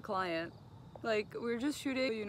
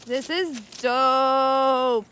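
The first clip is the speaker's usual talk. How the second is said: excited; 5 semitones higher, 13 dB louder, much slower than usual. Real, not acted. Reaching a cool shoot spot.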